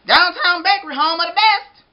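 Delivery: loud, cheery baker; hearty shop greeting; jolly baker voice. Acted. Baker answering phone.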